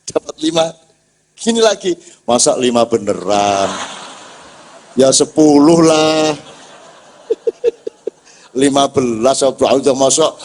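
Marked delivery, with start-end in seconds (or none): 2.2-6.3 cheeky haggling. Retold or imitated. Fisherman pushes God for ten fish, mock cheeky. 7.3-8.1 a quick high burst of laughter. Real, not acted. Preacher laughs at his fisherman joke, short high laugh.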